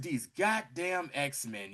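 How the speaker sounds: villain grumbling quietly, slowly; muttered exasperation. Retold or imitated. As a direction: grumble it under the breath, slowly. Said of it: "He said".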